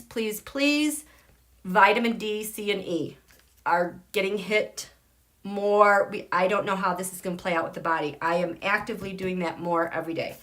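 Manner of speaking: usual speaking voice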